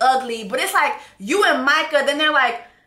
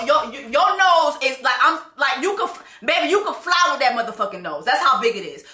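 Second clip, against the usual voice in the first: ordinary talk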